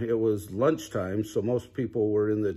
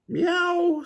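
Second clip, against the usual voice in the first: very high held meow. A narrator's reading. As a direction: stretch a high cat meow. Cat noise in story.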